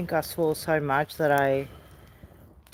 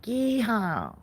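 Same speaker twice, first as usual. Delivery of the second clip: cowboy yeehaw; 5 semitones higher, 4 dB quieter than usual. Retold or imitated. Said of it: "He's like".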